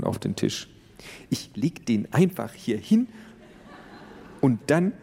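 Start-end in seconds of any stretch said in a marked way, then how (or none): 1.3-4.9 timid neighbor. Acted.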